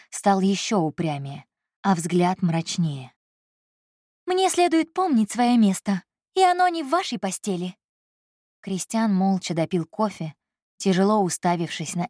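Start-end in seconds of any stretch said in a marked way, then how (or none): 4.3-7.7 put-on higher, firm young woman's voice; a proud secretary holding her ground; Elsa's voice. Secretary refusing her boss, higher and firm. Elsa speaks again.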